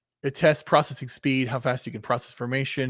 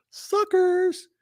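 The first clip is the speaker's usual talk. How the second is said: mock taunt. Real, not acted. Taunting viewers mid-explanation.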